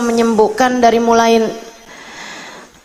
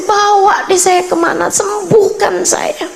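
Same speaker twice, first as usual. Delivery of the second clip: high-pitched pleading, lightly acted; retold desperate plea; 7 semitones higher than usual. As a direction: plead as if begging to be cured. (memohon putus asa, menirukan)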